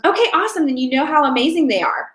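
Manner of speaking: high, upbeat scripted reply; cheery sales-call affirmation; enthusiastic caller. Shown as a demonstration. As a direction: respond with bright enthusiasm, starting high. Demo caller's reply to a yes.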